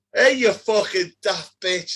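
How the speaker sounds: loud, high Yorkshire shout; angry Yorkshireman, imitated; Yorkshire accent. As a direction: yell it slowly in a put-on Yorkshire accent. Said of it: "I'm doing the fucking Yorkshire accent".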